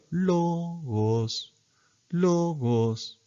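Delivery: "Logos" sung high on the first o; acute pitch accent. Shown as a demonstration. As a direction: lift the first syllable of "Logos" up a fifth. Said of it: "el agudo va en el arriba".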